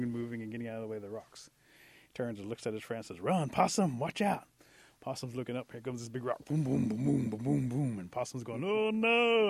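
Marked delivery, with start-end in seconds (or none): 3.2-4.5 loud, high warning shout; urgent warning. Acted. Rabbit warning Possum of rolling rocks, shouting. 6.4-8.0 loud bouncing booms; booming rock noise. A narrator's reading. Storyteller imitating a big rock rolling down, booming. 8.6-9.5 high, loud wail of dread; alarmed cry. Acted. Possum seeing the rock coming, crying out.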